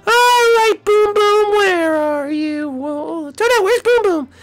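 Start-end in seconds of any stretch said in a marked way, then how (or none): none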